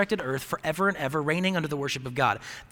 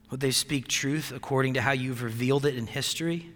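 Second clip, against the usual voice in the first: soft, low pastor's prayer; a quiet pulpit prayer, lower and softer; earnest prayer; 4 semitones lower, 7 dB quieter than usual. Acted. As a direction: pray it humbly to God, unhurried. Pastor praying before sermon.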